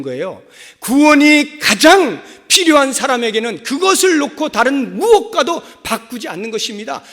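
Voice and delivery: loud, high-pitched pulpit proclamation; full-force preaching. Acted. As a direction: build to a shouted sermon climax. (목청 높여 외치는 설교)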